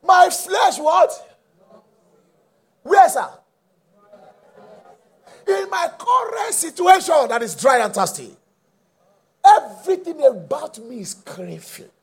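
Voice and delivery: high, slow, loud psalm exposition; impassioned preacher driving the verse home; fervent preaching. Real, not acted. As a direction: call it out to the congregation, stretching key words. Preaching the psalm at full force.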